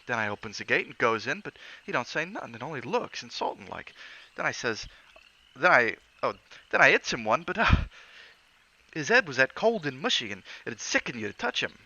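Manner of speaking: Cockney lad retelling a brawl; Cockney bellboy. Acted. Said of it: "Thomas' Cockney dialect".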